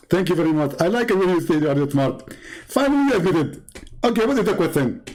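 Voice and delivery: a hint of Zakir Naik, smug and dismissive; mock Zakir Naik. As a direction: parody a smug preacher answering a caller. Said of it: "Hey, Zakir Naik".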